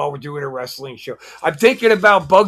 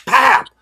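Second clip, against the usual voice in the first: imitated slap; 10 semitones higher, 8 dB louder than usual. Slap acted out in story.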